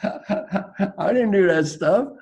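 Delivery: laughing through a mock denial. Real, not acted. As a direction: chuckle while insisting it wasn't you.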